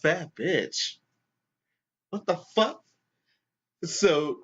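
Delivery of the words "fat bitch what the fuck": higher, offended repeat of the insult; mock indignation, voice jumping up; imitated stunned outrage; outraged disbelief. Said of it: "the lady with the cake is going".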